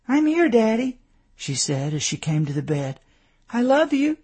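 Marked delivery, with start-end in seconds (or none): 0.1-1.0 put-on very high child voice; small daughter greeting her daddy; little girl's voice. A narrator's reading. 3.5-4.2 put-on very high child voice; little girl's voice. A narrator's reading. Little daughter's voice in story.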